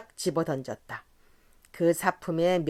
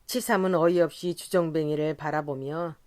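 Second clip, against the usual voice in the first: ordinary delivery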